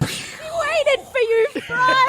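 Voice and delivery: high, drawn-out wailing cry; slow crying wail, high and laughing; crying quote. Retold or imitated. Cold open quoted cry.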